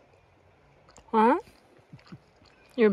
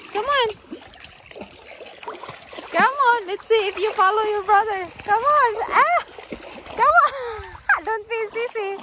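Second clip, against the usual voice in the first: high, bright-pitched coaxing of a dog; high coaxing call; 6 semitones higher, faster than usual. Real, not acted. Owner coaxing her dog into the river, high coaxing call. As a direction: urge the puppy into the cold water.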